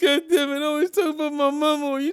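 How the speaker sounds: high, loud, whiny mock sob-rant; mock crying rant. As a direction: whine it out like a crybaby having a meltdown. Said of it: "he just on there like".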